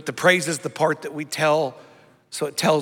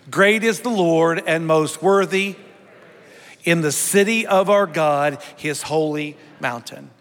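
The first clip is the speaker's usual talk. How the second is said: leading congregational psalm reading; 3 semitones higher, 4 dB louder than usual. Acted. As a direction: declare it for a whole church to join.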